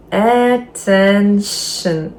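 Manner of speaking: ATTENTION stretched very slowly, syllable by syllable; slow, separated syllables in attention; drawn-out word. Shown as a demonstration. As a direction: draw out attention like a very long word. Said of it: "Дуже довге слово".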